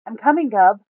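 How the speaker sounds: loud reply across the hill; shout. A narrator's reading. Buma answering Gub from afar, shouting. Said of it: "shouts Buma".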